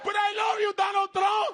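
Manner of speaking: high, loud hammed-up cry of love; mock adoring shout. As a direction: yell it like a devoted fan. Supporter shouting love for Trump.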